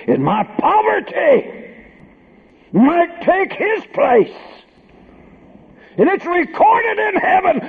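high, loud preacher's climax; soaring sermon crescendo, easing at the end; full-force preaching. Acted.

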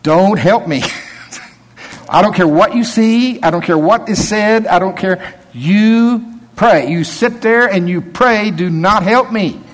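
firm warning to the helpers, voiced lightly; preacher voicing his friend's strict rule; Michael's instructions. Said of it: "Michael says".

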